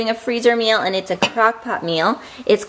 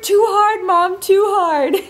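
put-on high, strained little-kid wail; hammed-up high whine, slowing at the end; imitated straining toddler; 10 semitones higher, 3 dB louder, slower than usual. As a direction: mimic a toddler pushing as hard as she can. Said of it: "as hard as she can, and she goes".

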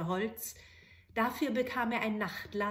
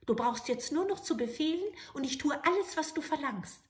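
higher, brisk little goblin voice; little grey man; 6 semitones higher, 3 dB louder than usual. Acted. Manikin explaining the magic light to the soldier. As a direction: rattle off the rules eagerly.

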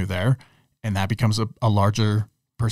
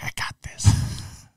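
whispered; 6 dB quieter, much slower than usual. Pretend. Quoting a cocky listener.

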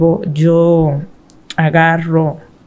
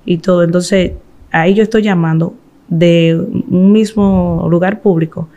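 normal talking voice

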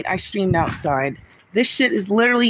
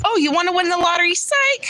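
fast, high sarcastic teasing; mock taunt; 11 semitones higher, faster than usual. Streamer mocking her own bad luck, fast high taunt. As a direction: taunt like a cruel joker.

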